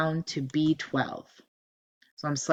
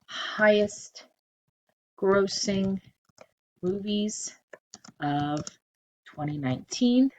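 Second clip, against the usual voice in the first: slow dictation; 3 semitones higher, slower than usual. Shown as a demonstration. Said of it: "We're gonna call this".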